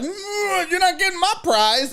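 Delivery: high-pitched defiant cat; cat's voice. Retold or imitated. Cat keeping lizard from owner, high defiant voice. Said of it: "And he's like".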